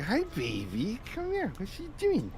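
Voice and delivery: put-on high, soft baby talk to a cat; kitty cat voice. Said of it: "a little kitty cat voice for her".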